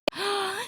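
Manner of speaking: quiet thrilled intake of breath; hushed excited gasp; soft gasp. Acted. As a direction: breathe in with wonder. Gasp at exotic vectors.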